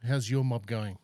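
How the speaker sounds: ordinary delivery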